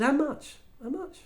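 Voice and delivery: can't believe it, push for the number; incredulous. Retold or imitated. Arrogant speaker to Tish, squeaky disbelief.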